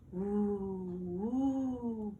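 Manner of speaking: long hollow woo of the wind; wind howl. A narrator's reading. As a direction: moan it like wind through trees. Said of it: "blew the wind in the trees".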